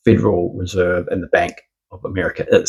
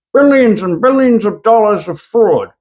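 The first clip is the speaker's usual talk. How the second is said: high, exaggerated mock awe; mock hype; 11 semitones higher than usual. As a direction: hype up an enormous figure in a high voice. Mock hype about huge fraud.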